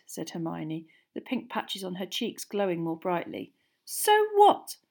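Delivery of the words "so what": very high, loud challenge; defiant. A narrator's reading. Girl daring her jealous friend to object, defiant. Hermione defies Ron.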